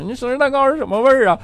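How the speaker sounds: put-on high, eager kid asking; a kid begging dad for an answer, high and eager; childlike question. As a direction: pitch it up like a curious child.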